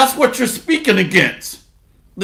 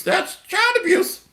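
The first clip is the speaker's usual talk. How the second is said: much higher, softer mock whine; mock falsetto; 10 semitones higher, 5 dB quieter than usual. Preacher mocks the complaint, high and soft. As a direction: whine it up high like a fake victim. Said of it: "repeat, softer mock".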